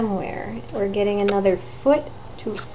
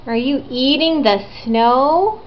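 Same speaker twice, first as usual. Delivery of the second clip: usual speaking voice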